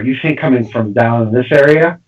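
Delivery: ordinary talk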